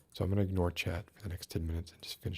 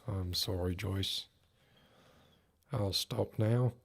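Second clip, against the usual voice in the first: ordinary delivery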